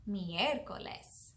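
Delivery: slow, drawn-out "Miércoles" for learners; slow demonstration. Shown as a demonstration. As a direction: say "Miércoles" slowly and clearly. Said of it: "I'll say that one again 'cause it can be a little tricky".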